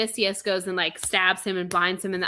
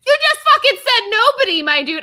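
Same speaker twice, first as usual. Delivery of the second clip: much higher, louder exasperated yell; outraged shout; 10 dB louder than usual. Real, not acted. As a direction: blast him with disbelief. Outraged retort to director.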